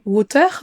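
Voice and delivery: WATER with a French R at the end; French-style water. Shown as a demonstration. Teacher showing learners the mistake, French-accented WATER. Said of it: "On ne dira pas".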